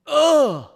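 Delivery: loud, high theatrical "ugh"; exasperated groan. Acted. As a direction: groan loudly, as if life is unbearable. Caps the mock internet tantrum.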